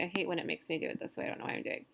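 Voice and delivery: fast under-the-breath grumble; soft grumbling aside, barely voiced; frustrated mutter. Real, not acted. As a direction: mutter it to yourself, annoyed at the tool. Frustrated aside while working the screen.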